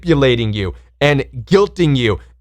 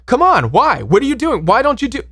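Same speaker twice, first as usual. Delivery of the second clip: pushy pressuring voice; 10 semitones higher than usual. Retold or imitated. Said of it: "You're like".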